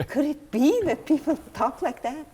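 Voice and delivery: loud, high question through a laugh; laughing amazement. Retold or imitated. Narrator to the praying man, laughing in loud disbelief.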